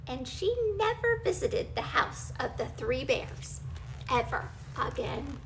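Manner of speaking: ordinary delivery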